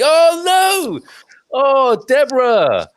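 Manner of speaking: high, loud Oh no of sympathy; dismayed cry. Real, not acted. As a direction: cry out in dismay at the sad news. Reacting to sad comment.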